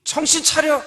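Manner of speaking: loud, high wake-up command; God shouting wake up; stern shout. Shown as a demonstration. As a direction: jolt the listener awake. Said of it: "하나님의 말씀이 우리에게".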